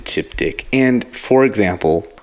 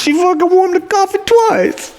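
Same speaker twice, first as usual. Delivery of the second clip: squeaky high indignant protest. Pretend. Voicing indignant customer.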